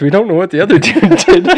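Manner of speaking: laughing through the words; giggling while telling. Retold or imitated. Retelling club guy's answer, laughing.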